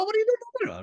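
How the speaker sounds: very high, squealing cartoon voice; high Robin Williams-style character voice. Shown as a demonstration. Teacher showing Robin Williams switching voices: very high squeal. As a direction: pitch it way up like a zany character. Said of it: "get back to Robin Williams".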